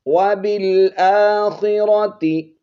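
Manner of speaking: slow, high melodic recital; Quranic recitation. Shown as a demonstration. Teacher reciting verse to students. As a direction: chant it out slowly.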